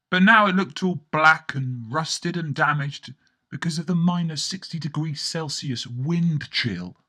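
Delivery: ordinary delivery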